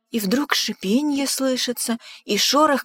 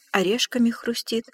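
plain reading voice

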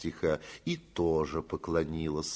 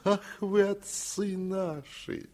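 higher, slow sing-song of a tipsy bailiff; sing-song fawning; 11 semitones higher, slower than usual. A narrator's reading. Bailiff croons to the master in a sing-song. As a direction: croon it out, all tender servility. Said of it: "заговорил он нараспев и с таким умилением".

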